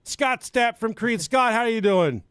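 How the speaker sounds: high, loud hype host; announcer intro. Pretend. Host opening the podcast, high hype announcer voice. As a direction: sell the show like a ring announcer.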